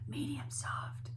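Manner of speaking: hushed, near-whispered demonstration; soft and breath-light, like the dynamic named; medium soft. Shown as a demonstration. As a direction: drop right down to show soft. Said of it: "mezzo piano or medium soft".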